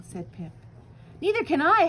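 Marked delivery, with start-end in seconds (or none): 1.2-1.9 higher, louder wail; howl. A narrator's reading. Flitter howling, lost in the dark, high and loud. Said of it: "howled Flitter".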